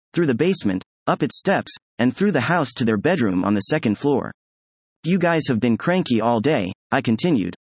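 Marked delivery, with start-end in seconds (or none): none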